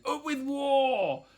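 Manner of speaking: high, drawn-out war cry; shouting. A narrator's reading. Tyrannosaurus family calling for war, high shouting. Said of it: "And they shouted".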